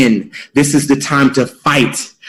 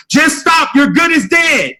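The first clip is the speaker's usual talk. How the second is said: higher, slower yell from the pit's edge; yelling louder; 11 semitones higher, slower than usual. Acted. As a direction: shout it down at the frog, louder now. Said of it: "they're yelling louder".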